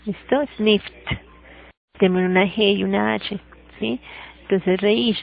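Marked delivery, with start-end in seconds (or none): 0.6-1.2 "Sniffed" as one syllable, snift; "Sniffed" with no e in the ending. Shown as a demonstration. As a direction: finish "Sniffed" with a released t. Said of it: "también va a sonar como una T".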